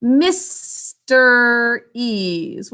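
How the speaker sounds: "Mister E's" stretched very slowly into syllables; extremely slow segmented "Mister E's"; slow syllable split. Shown as a demonstration. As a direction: drag out "Mister E's" part by part for dictation. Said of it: "syllables dictated".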